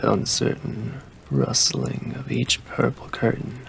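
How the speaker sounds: usual speaking voice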